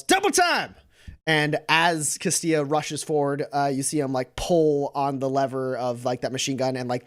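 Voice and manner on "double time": high-pitched battle yell. Acted. Castilla orders the charge while rushing forward, yelling high. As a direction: call it out high, like a rallying order. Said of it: "Castilla yells".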